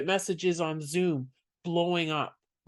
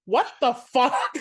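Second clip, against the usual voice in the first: higher, drawn-out incredulous question; retold disbelief; 6 semitones higher, 4 dB louder, slower than usual. Comic retelling her shocked reaction to an awful set, drawn-out disbelief. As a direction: stretch it out in amused, exaggerated disbelief.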